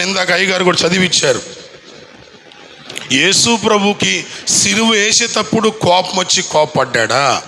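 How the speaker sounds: ordinary talk, nothing marked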